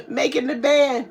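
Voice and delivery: laughing through the words; giggling, cracking herself up. Real, not acted. Streamer laughing with chat about the sing-a-thon, giggling.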